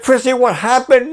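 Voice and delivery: high, anxious pleading repeated; worried father. Retold or imitated. Father urgently asking his crying daughter, high and anxious. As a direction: press her, frightened for her. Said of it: "I said".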